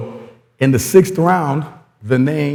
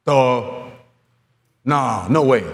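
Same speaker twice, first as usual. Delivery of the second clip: normal talking voice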